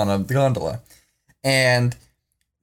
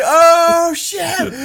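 high, loud whoop of the crowd; storyteller echoing the crowd's loud, high whoop; mock crowd yell; 13 semitones higher, 3 dB louder than usual. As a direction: cheer it like a car full of friends losing it. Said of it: "Everyone's yelling".

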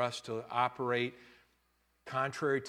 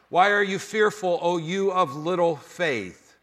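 scripture reading; 6 semitones higher, 9 dB louder than usual. Acted. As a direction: read it out like holy writ.

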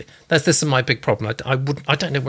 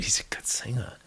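whisper; 2 semitones lower, 10 dB quieter than usual. Real, not acted. Host's awed aside about singing.

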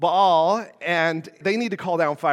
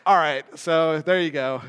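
ordinary delivery

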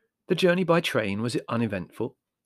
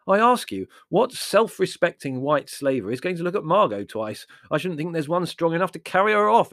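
loud, high exasperated rant; declaiming in loud impatience; 7 semitones higher, 6 dB louder than usual. Acted. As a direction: argue it with incredulous scorn. Larry's exasperated rhetorical rant.

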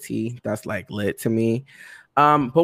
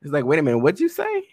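teacher's confusion; 4 semitones higher, 4 dB louder, much faster than usual. Retold or imitated. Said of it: "the teacher's like".

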